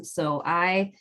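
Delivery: normal talking voice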